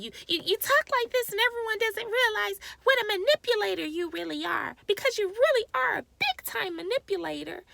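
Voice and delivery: high-pitched mimicry of another woman's voice; mocking imitation. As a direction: imitate her in a sustained, put-on high voice. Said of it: "You talk in this voice".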